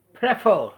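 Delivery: a teacher showing the stress on Prefer; stress on FER. Shown as a demonstration. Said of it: "second syllable is here stressed".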